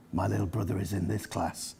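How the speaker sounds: put-on low, soft, unbudging boy's voice; quietly insistent older brother, well below normal pitch; stubborn Chinggis. A narrator's reading. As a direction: answer low and quiet, refusing to budge. Chinggis contradicts the teacher.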